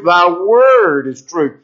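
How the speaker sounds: slow line with a big, drawn-out "word"; stress on "word". Shown as a demonstration. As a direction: lean hard on "word", drawing it out. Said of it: "Notice the emphasis on the word".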